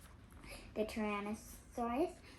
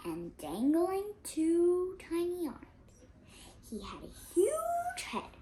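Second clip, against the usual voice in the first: dramatic; 6 semitones higher, 4 dB louder, slower than usual. A narrator's reading. Describing the T-rex dramatically.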